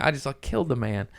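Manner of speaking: mock panicked confession; about to cry. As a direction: rush it out, nearly tearful. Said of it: "I'm, like, about to cry".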